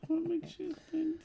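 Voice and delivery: very high, sly little lizard voice; chameleon. Acted. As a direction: squeak it up high like a scheming tiny lizard. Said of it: "When you talk in that little lizard voice".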